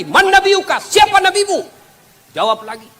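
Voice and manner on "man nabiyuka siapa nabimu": high, loud interrogating angel; stern angel voice. Acted. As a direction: question the dead like a fearsome angel. (suara malaikat yang menginterogasi dengan keras)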